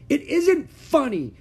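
very high, loud, slow shout; angry shout. Acted. Ramona shouts at her laughing family. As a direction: yell it at them in fury. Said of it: "shouted Ramona".

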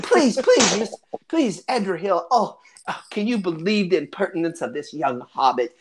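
put-on high, fussing old lady, easing down toward the end; gushing granny appalled by rude youngsters; scandalized hobbit grandmother.

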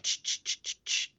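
rhythmic unvoiced "ch" static; static noise imitation. Shown as a demonstration. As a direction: mimic radio static sweeping. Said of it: "all I'm hearing is the ch".

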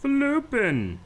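silly high voice. Pretend. Friend toys with the odd word, high squeak. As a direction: stretch "Floopin" in a high, playful squeak. Repeating a funny made-up word.

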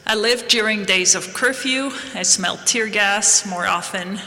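ordinary delivery

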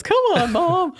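put-on high whiny kid pleading; complaining voice. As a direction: whine at mom like a begging kid. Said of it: "That's my complaining voice".

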